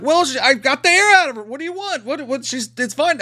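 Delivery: high, stammering self-defence; imitating a frantic guilty guy; mock flustered panic. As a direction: blurt excuses in a hammed-up high voice. Acts out killer's flustered excuse.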